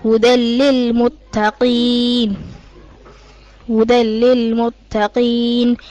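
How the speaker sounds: slow, measured tajweed chant; Quran recitation. Acted. Student reciting Baqarah to her teacher, chanted. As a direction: recite each verse as a trained reciter.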